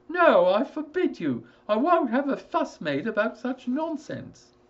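high, loud, obstinate refusal; a stubborn father shutting his son down; stubborn forbidding father. Acted. Father voiced in story.

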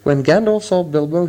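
ordinary delivery, nothing marked

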